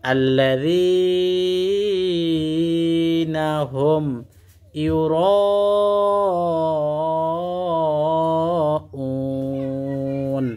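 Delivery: slow, drawn-out chanted recitation; Quran recitation. Shown as a demonstration. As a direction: recite with long melodic stretches. Recites the verse. (ধীর সুরেলা কুরআন তিলাওয়াত)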